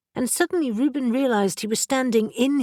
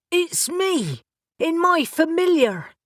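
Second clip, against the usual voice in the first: higher, louder insistent call; urgent repeated yell; 9 semitones higher, 3 dB louder than usual. A narrator's reading. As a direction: shout it again, pleading. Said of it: "Reuben repeated".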